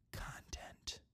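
hushed whisper on the last word. Pretend. Teasing patrons, whispering. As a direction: breathe the word like a secret.

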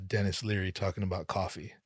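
ordinary delivery

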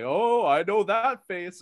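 hammed-up knowing drawl, high, loud and slow; mock dramatic line. Mocking a cheesy TV doctor, hammed-up knowing line. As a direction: ham up the cheesy doctor's reveal.

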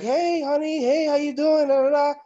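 high, loud, sing-out hello; hammed-up high-pitched greeting; mock cheery greeting. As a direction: imitate an arriving partner calling out. Fiancé greets her on arrival.

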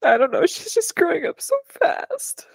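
high, breaking voice through tears; crying while talking, ending in a whisper. Real, not acted. Mom crying about her daughter growing up, tearful whisper. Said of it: "You're gonna make me cry".

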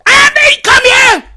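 high, loud mock yell across the house; imitating a mom yelling for her son; parent shouting. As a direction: holler like a parent calling a kid. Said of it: "And they were shouting loud".

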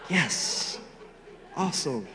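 soft, acted little victory; hushed self-congratulation. Young man privately pleased by God's supposed sign, quietly triumphant. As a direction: savor the win under your voice.

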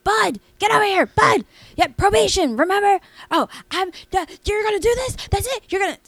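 mock her screeching at Bud; shrill drunk wife. Bartender imitating the drunk wife yelling at her husband. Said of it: "She was like".

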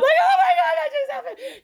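very high squealed mock sob; mock happy crying. Singer reliving getting home soaked, tearfully overjoyed. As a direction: squeal it as if weeping with joy. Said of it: "crying happy tears".

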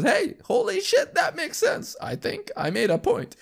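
very high, squeaky self-surprise; mock amazement. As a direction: act thrilled that it actually worked. Acting out amazed realization.

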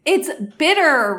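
loud, drawn-out complaint; exploding. Acted. Inessa having just spat out the coffee, exploding. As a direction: wail it in disgust, stretching the word. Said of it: "Inessa explodes".

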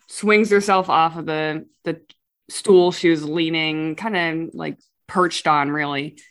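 usual speaking voice

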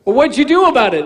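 a believer pressing Jesus for an answer; eager questioner. Acted. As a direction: fire the question at him.

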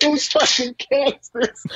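very high, incredulous imitation; hammed-up squeal on the edge of laughter; Michael Rappaport. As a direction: demand an answer in mock outrage. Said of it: "That is where he's like".

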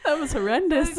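high, loud, laughing it out; cracking up while judging. Real, not acted.

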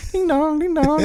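put-on high-pitched kid voice; little kids. Imagined trick-or-treaters calling at an empty house, high mock child voice. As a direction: sing it out like a small child at the doorbell.

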